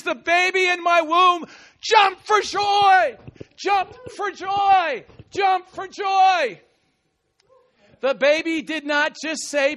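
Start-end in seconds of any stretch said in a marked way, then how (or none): 0.0-6.6 slow, shouted emphasis; preacher's slow proclaiming shout; preached loud. Acted. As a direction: hammer jumped for joy.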